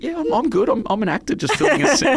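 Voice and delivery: put-on light, quick young boy brushing it off; a kid cheerfully saying he's fine, a bit fast; boy's voice. Boy actor reassuring the author, in a quick bright kid voice.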